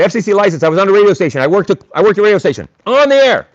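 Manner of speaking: frustrated emphatic streamer; exasperated insisting. Real, not acted. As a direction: prove it to a doubter who won't listen. Exasperated insisting to chat troll.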